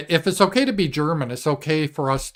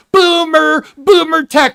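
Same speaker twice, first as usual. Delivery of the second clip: high, loud mocking squeal of boomer; shrill taunt at the old guy's tech; mocking boomer jeer; 14 semitones higher, 10 dB louder, slower than usual. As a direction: squeal it out like a taunting kid, drawn out. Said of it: "everyone should have sounded".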